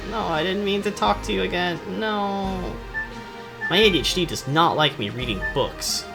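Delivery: ordinary delivery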